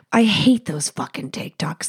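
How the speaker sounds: ordinary talk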